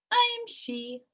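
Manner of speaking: high, off-hand admission; old lady admitting she is the witch; casually. Acted. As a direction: say it lightly, no fuss. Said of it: "the old lady answered casually".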